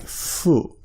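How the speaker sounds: fu said with a light breath through the lips; fu said halfway between H and F; soft F sound. Shown as a demonstration. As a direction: blow fu softly, halfway between H and F. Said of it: "more of an F sound".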